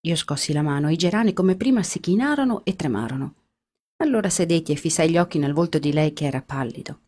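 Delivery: ordinary delivery, nothing marked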